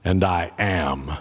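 put-on slow, deliberate German-accented professor's introduction. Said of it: "my German accent's probably terrible".

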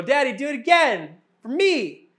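put-on a little girl demanding another spin from her dad; little daughter's voice. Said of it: "the first one will go".

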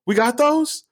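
high, astonished squeak; stunned disbelief. Retold or imitated. Time traveler hears about hover cars, high and astonished. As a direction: squeak it out in shock. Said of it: "I'm like".

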